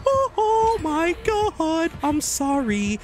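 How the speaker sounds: high, drawn-out wail; mock wailing apology.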